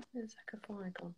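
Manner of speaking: whispered aside to herself; hushed, barely voiced; whispering. Real, not acted. Host mutters while searching for caller.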